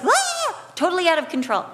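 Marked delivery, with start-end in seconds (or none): 0.0-0.6 short high mock shriek of panic; panicked scream. Daughter in a sunburn panic, high mock shriek. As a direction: let out a brief imitated panic cry. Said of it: "She was like".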